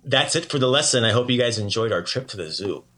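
normal talking voice

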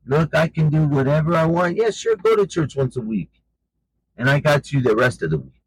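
mocking demons. Demons taunting a weak churchgoer, lightly voiced mockery. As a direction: lightly act out a demon's scornful gloating. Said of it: "they're laughing at you".

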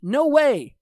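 high, loud mock refusal; hammed-up indignant no; emphatic refusal. Acted refusal, same character.

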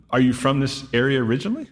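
normal talking voice

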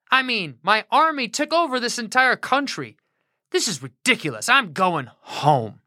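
high, huffy ranting general; a big shot insulted and fuming; pompous angry general. Acted. Naaman rants, insulted.

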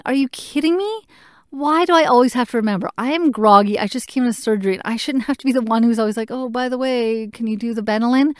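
exasperated inner rant at the nurse. Retold or imitated. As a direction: vent at having to remind them. Exasperated at forgotten medicine.

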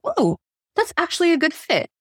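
high-pitched mock surprise; light up as if at an unexpected offer; pleasantly surprised. Podcast host handed a topic on a platter, pleasantly surprised. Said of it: "they go".

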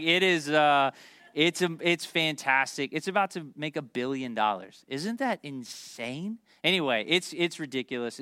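usual speaking voice

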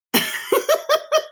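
a high, breathy, unvoiced giggle; airy giggle. Real, not acted. Host giggling about Las Vegas being interesting, airy giggle. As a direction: giggle under your breath.

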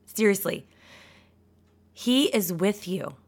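ordinary talk

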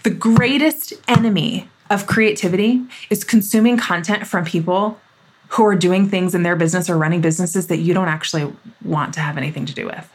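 ordinary delivery

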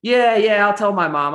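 brushing it off. Retold or imitated. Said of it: "And I was just like".

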